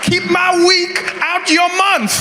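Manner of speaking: high, slow, shouted threat; mock shouted line. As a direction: yell it like a slap-worthy warning. Mock Will Smith line, shouted.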